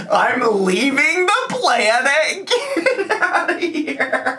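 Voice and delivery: high, drawn-out words dissolving into laughs; helpless laughter. Real, not acted. Vlogger overcome by the clip, squealing with laughter. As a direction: wail it slowly through real laughter. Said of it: "I can't stop laughing".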